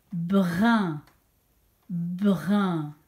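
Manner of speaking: "Brun" stretched long and low; dictated syllable. Shown as a demonstration. Teacher asks pupils to listen well, drawn-out low "Brun". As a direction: sound out "Brun" slowly and distinctly, twice. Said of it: "écoutez-la bien".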